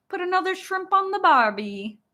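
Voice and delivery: movie-line Australian imitation; attempted Australian accent. Shown as a demonstration. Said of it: "And then Australian".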